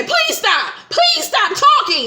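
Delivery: very high, building, imitated hysterical plea; mock crying scream. Wife begging husband to stop talking, mock crying scream. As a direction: screech it like a wife at breaking point, hammed up. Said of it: "And she crying and screaming".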